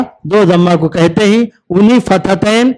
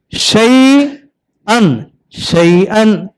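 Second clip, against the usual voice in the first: slow drawn-out demo; much slower than usual. Shown as a demonstration. Teacher modelling शैअन slowly for the child. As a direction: drag शैअन out very slowly, syllable by syllable. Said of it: "आ दन, आदन".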